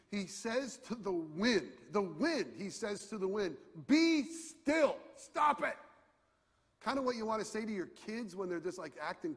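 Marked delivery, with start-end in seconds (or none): 3.8-5.8 high, loud command to the storm; commanding yell. Retold or imitated. Jesus rebukes the wind with a loud high command. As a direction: order the wind to stop. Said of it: "he yells at the wind".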